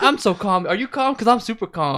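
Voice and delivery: high, loud wired babble; hammed-up adrenaline rush; manic. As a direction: insist on being calm while sounding hyped-up. Acting Mikey wired on adrenaline.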